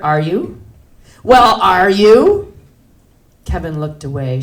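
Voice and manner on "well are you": loud, drawn-out challenge; insistent demand. Acted. Customer grilling the clerk, loud drawn-out demand. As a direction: push the question hard, slowly, voice rising.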